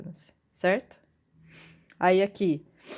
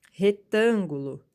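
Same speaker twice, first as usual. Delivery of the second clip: Retângulo slowly, TÂN loud and stretched; antepenultimate stress; 5 dB louder, slower than usual. Shown as a demonstration. Teacher asking which syllable is strong, stressing the antepenultimate. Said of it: "A antepenúltima".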